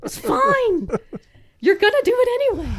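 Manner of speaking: squeaky high, loud mock exasperation; exasperated teasing in a shrill voice. Wife teasing her husband about bringing up Nazis, high mock exasperation. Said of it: "it was like".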